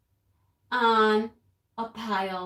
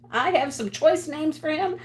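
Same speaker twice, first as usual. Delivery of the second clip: usual speaking voice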